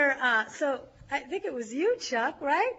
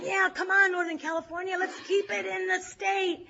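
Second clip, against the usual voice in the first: higher-pitched shout across the room; retold shouting; 7 semitones higher than usual. Southern California delegates coaxing the north across, a shout. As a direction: call out to win the other side over. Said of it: "Southern California is saying".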